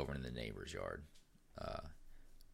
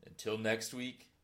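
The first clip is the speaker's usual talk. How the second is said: announcer sign-off; 6 semitones higher, 7 dB louder than usual. Acted. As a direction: close the show like an announcer. Show sign-off.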